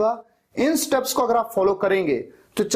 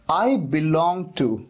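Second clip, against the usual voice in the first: very slow model phrase; 3 semitones lower, much slower than usual. Shown as a demonstration. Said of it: "दो तरीके आपके सामने हैं".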